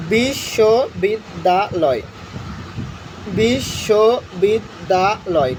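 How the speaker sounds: বিশ্ববিদ্যালয় drawn out syllable by syllable; বিশ্ববিদ্যালয় spelled out slowly, bish-sho-bid-da-loy; slow syllable count. Shown as a demonstration. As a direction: stretch বিশ্ববিদ্যালয় slowly so students can count. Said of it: "counting syllables slowly".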